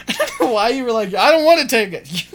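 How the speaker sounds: laughing. Real, not acted. Mocking opponent while laughing.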